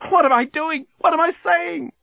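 very high, distraught wail; tearful cry. A narrator's reading. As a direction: wail in panic at yourself. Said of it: "cried Lucy, tears in her eyes".

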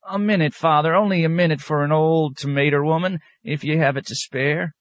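put-on high old country woman rambling excuses; old woman excusing her dog to the abbot, high and wheedling; wheedling old peasant woman. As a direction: plead for the dog in a high backwoods voice.

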